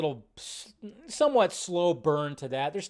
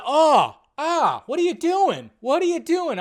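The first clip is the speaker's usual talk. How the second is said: high, louder, horrified "what are you doing"; shocked disbelief; 12 semitones higher, 8 dB louder, slower than usual. Retold or imitated. Viewer shouting at the screen in horrified shock. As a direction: recoil in shock, pitched way up. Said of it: "I was going".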